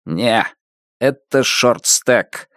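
flat, slow robotic voice; monotone electronic voice. A narrator's reading. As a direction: say it like a dull machine. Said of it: "монотонно ответил электронный голос".

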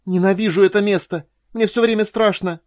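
high, loud, desperate cry; distraught woman crying out in fear; frightened outburst. A narrator's reading. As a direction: burst out against your will, voice pitched high. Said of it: "у Корделии против воли вырвалось".

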